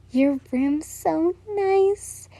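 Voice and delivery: slow, soft and high, smiling; soft pleased girl's voice. A narrator's reading. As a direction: let the smile into the words, slowly. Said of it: "you smiled".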